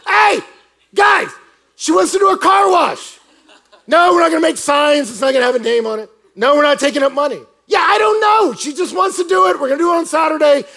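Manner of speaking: high, bellowed call to a crowd; hammed-up yelling; loud rallying shout. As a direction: holler to round everyone up. Rallying the group on her behalf.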